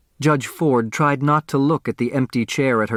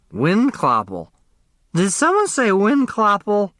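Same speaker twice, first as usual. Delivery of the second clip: slurred; 9 semitones higher than usual. Acted. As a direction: slur the name like a tipsy guest. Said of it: "Grace Wexler slurred".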